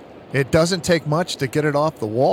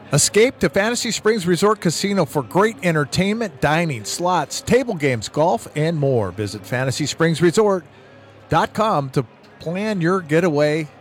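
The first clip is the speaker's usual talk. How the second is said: ad read; 4 semitones higher than usual. Acted. Reads a casino ad.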